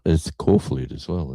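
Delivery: usual speaking voice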